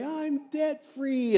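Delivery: high, slow, stretched-out cry of joy; excited caller. Retold or imitated. Preacher imitating a debt-free caller's high joyful cry. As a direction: lift it way up like sudden relief. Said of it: "you watch the exuberance of these people when they say".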